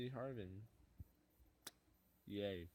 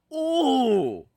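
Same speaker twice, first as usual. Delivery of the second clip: sudden loud high cry of delight; real burst of excitement; excited shout; 26 dB louder than usual. Real, not acted. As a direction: yell out at a big pull. Huge pull in pack opening.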